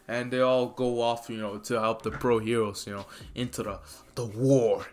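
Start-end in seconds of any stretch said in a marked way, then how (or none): none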